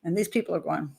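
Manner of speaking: plain reading voice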